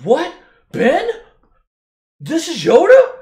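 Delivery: very high, loud squeals of disbelief; mock shock, each question shooting up; Luke incredulous. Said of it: "Luke goes".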